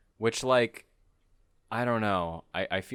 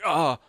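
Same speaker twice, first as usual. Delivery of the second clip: mock yelp; 9 semitones higher, 8 dB louder than usual. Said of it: "and he's like".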